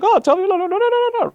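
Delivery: very high, mock-frantic interruption; panicked lawyer. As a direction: imitate a lawyer rushing in to stop it. Lawyer runs up to cut in.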